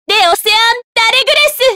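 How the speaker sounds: high, climactic spell call; attack cry. Acted. Game heroine sending the angel heavenward, high spell cry. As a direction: lift the pitch to cast it. Calling out special attack name.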